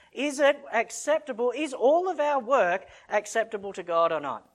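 loud, rising sermon push; full-force preaching. Real, not acted. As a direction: preach it hard to the congregation.

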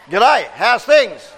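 slow shout of G'day, how's things; shouted greeting. Shown as a demonstration. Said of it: "calling out the cue".

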